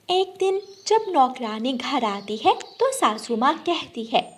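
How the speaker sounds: ordinary delivery, nothing marked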